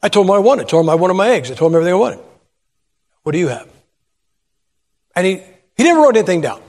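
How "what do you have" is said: lightly voiced hulking waiter; a hint of a huge kid demanding the order; big brusque waiter. Huge young waiter asks diners for orders, lightly imitated brusque voice. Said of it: "He comes out like this".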